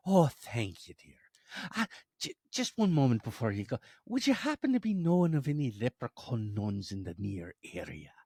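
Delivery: polite, lilting leprechaun voice. Acted. Leprechaun asking Mother Superior at convent door, polite lilt. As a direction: play a courteous little leprechaun caller.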